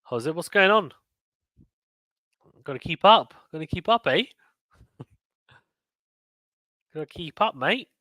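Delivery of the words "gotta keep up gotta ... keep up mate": put-on playful Australian accent with rising endings. Streamer urging a player to keep up, playful Australian accent. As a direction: put on a light, joking Aussie accent. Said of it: "I'm speaking Australian accent".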